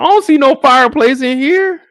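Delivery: loud, high comic yell; loud exclamation. Real, not acted. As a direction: yell it out in mock outrage. Comparing his room, exclaiming.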